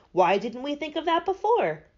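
high, lively outburst; excited cry. A narrator's reading. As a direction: cry it out as if struck by the idea. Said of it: "cried the Scarecrow".

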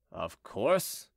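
low, grudging growl. A narrator's reading. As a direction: grumble it low, under mild annoyance. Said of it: "growled Chicot".

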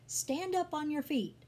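high, ringing command; loud command. A narrator's reading. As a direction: call out loudly as an order. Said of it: "Paul said in a loud voice".

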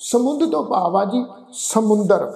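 ordinary delivery

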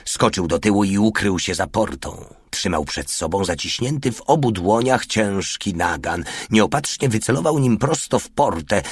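ordinary delivery

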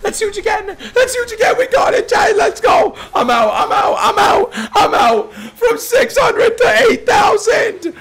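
rising to high, happy shouts; building excitement. Real, not acted. Streamer cashing out a big win, building to joyful shouts. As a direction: climb from a grin to yelling.

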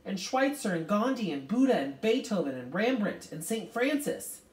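high, loud, assured listing; ringing with confidence. A narrator's reading. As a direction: call the names out confidently. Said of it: "Calvin's voice rang with confidence".

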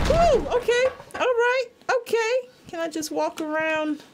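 shaky brave-face after a fright; nervous high voice. Real, not acted. Startled in horror game.

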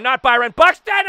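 high, loud cry of dismay; alarmed outcry. Real, not acted. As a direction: yelp in protest at the play. Cries out at opponent's catch.

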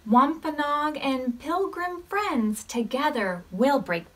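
gentle storybook read-aloud; reading to children. Acted. Teacher reading a counting story to the class, gently. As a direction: read it like story time for little ones.

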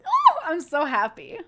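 high, loud and drawn out; slow squeal of happiness; overjoyed. Real, not acted. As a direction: gush it with real delight. Overjoyed at the good news.

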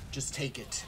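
whisper. Real, not acted. Urging the opponent quietly.

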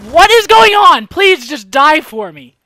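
high shout easing into a slow, loud plea; exasperated yelling. Real, not acted. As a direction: yell in disbelief, then beg loudly. Enemy will not die.

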